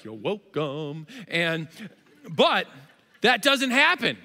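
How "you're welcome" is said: slow, self-satisfied; milk the compliment; smug Moses. Retold or imitated. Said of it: "Moses will be like".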